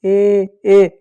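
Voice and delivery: drawn-out "ए", as if squeezed; squashed sound. Shown as a demonstration. Teacher shows the poor letter's squashed sound, strained "ए". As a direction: push out "ए" as a mock squashed cry. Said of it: "कैसा दबा हुआ निकल रहा है".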